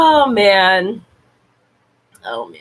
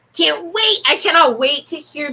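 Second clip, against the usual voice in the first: eager, gleeful repeat; can't contain the excitement; excited squeal; 5 semitones higher than usual. Real, not acted. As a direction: pitch jumps high on wait.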